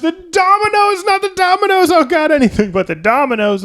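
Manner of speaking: high, loud frantic wail; mock panic. Doomed man panicking at the Rube Goldberg machine, shrieking. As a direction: shriek in rising panic about the dominoes.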